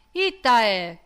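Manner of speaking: loud sharp shout in Sardinian. A narrator's reading. Brigadier startling his appuntato, loud shout. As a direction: bark the question across the room. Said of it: "gridò".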